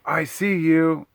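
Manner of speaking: high, slow mock yell from the woods; retold yell. Neighbor yelling through the woods, a mock yell. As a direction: call it out slow and high like a shout through trees. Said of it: "you can hear this guy through the woods yell".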